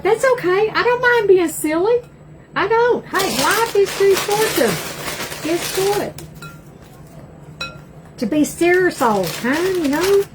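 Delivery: high, upbeat, carefree retort; playful reply to husband. Retold or imitated. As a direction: brush it off brightly. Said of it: "I'm like".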